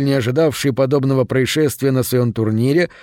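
ordinary delivery

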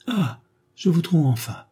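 narrated husband pouncing on his wife; jealous confrontation. A narrator's reading. Husband confronts his wife at last, accusing.

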